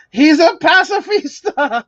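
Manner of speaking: high, slow, put-on reveal; dramatic reveal voice. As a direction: announce it like a villain.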